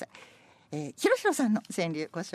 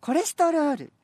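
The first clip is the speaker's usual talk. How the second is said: lively poem recitation; 9 semitones higher, 6 dB louder than usual. Acted. Rereads playful senryu.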